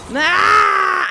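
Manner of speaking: sudden high yelp of fright; startled scream. Real, not acted. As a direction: jump and scream. Jump scare at the hand.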